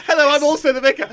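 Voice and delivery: laughing. Acted. Voicing vicar character, laughing.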